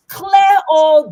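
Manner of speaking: loud, slow rallying cry; shouting. Real, not acted. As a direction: belt it out to the crowd. Rallying voters to sweep offices.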